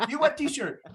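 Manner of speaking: very high, mock scolding; retold angry yelling. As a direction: imitate an irate shopkeeper shouting an order. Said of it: "She starts just yelling at me".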